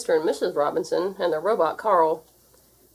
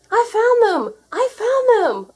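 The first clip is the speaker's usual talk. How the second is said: much higher, loud excited cry; cried out; 8 dB louder, slower than usual. A narrator's reading. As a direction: shout it in triumph. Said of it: "I cried".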